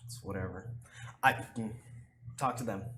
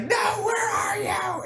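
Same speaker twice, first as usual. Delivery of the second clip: very high, slow shouted call; mock chasing shout; 7 dB louder, slower than usual. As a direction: yell after him as you give chase. Captor chasing, retold.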